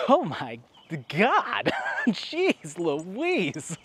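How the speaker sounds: mock shock. Imitating a shocked movie character.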